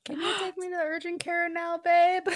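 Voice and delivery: high sheepish plea to babe; pleading. Retold or imitated. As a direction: ask sweetly, a little helpless. Retold plea to partner.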